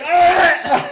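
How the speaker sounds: high, loud shriek through laughter; startled shriek. Retold or imitated. Mom shrieking as cereal hits her mouth. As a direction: shriek in shock, half laughing. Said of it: "my mom's like".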